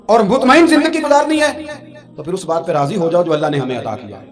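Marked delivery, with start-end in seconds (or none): 0.0-1.6 rising exhortation. Acted. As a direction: lift the youth with a loud call. Preacher exhorts the youth. (نوجوانوں کو بلند آواز میں پکار)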